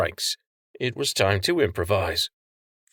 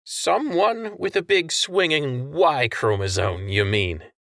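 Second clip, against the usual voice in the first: taunting; 6 semitones higher than usual. A narrator's reading. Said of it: "Tran taunted".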